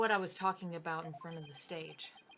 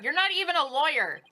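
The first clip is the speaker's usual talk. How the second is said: indignant; 8 semitones higher, 10 dB louder than usual. Acted. Voicing a rival arguing.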